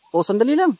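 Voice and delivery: loud high chasing shout; shouting after her. Real, not acted. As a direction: yell after her as she runs.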